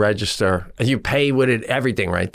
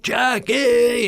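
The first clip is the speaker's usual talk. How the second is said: calling out; 12 semitones higher than usual. Retold or imitated. Cheering runner passing by.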